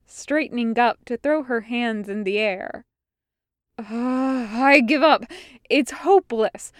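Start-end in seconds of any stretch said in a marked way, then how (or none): 3.8-6.7 groan, then loud exasperated surrender; despairing exclamation. A narrator's reading. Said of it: "she exclaimed".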